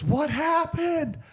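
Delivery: high-pitched, drawn-out mimicking of mom; hammed-up high wail of a question; mock startled mom. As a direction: imitate mom's question, stretched out high. Acting out mom's question in retold story.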